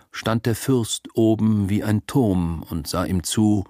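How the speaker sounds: plain reading voice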